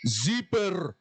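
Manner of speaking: mock Russian dad shouting "Zipper"; retold loud "Zipper". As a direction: bark out "Zipper" like the big dad did. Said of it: "he says it really loud".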